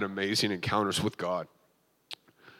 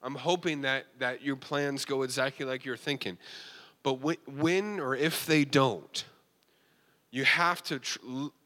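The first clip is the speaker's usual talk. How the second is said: preaching; 4 semitones higher than usual. Real, not acted. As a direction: exhort the congregation with rising fervor. Preaches to the congregation.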